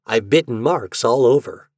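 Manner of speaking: ordinary delivery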